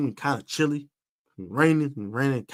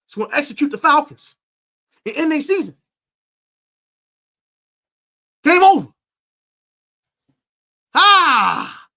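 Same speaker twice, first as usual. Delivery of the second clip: much higher and louder, rising into a yell; hype shout; 11 semitones higher, 11 dB louder than usual. Real, not acted. Hyping the stream over the predicted win with a triumphant yell. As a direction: whip the stream into a frenzy.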